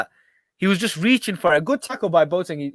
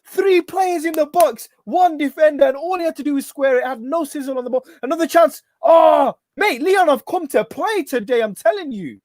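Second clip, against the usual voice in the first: excited; 11 semitones higher than usual. Real, not acted. Live reaction to attacks.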